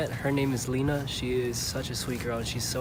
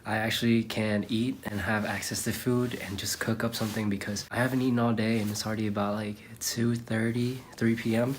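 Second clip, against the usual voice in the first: ordinary talk, nothing marked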